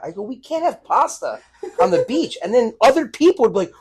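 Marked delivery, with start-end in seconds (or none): 0.2-2.4 put-on kid protesting. Kid protesting pasta at beach.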